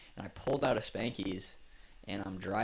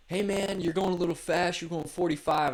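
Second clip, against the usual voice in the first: mock cop; 8 semitones higher, 7 dB louder than usual. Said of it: "he's like".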